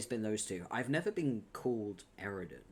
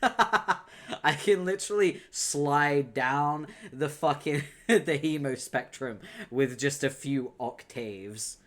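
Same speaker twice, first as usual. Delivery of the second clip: laughing; 4 semitones higher, 7 dB louder, slower than usual. Real, not acted. Laughing at her own voice.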